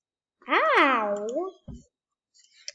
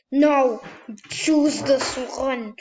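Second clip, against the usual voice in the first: loud urgent yell; loud frustrated command; shouting at the game; 6 dB louder than usual. Real, not acted. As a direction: yell the order at the screen. Yelling at game.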